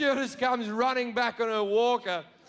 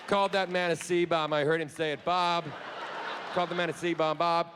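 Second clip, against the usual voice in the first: low-pitched imitation of murmuring onlookers; lightly voiced scandalised passengers, pitched down; gossiping passengers; 6 semitones lower than usual. Passengers telling each other what he said, low mock gossip.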